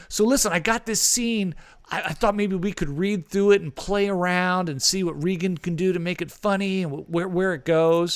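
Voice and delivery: excited rush of ideas, easing down toward the end; Michael Bay. Retold or imitated. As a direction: imitate a keyed-up director selling an idea. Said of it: "he was just bouncing off the walls".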